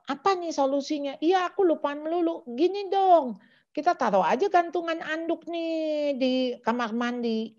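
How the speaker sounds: high, light role-play voice; acted parent-child exchange. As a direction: play out a family brainstorm in a high voice. Acted parent-child talk about solution.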